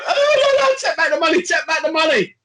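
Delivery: high, loud, frantic begging; mock panicked pleading. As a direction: beg him to take the money back in a hammed-up panic. Mock panic quote of the friend.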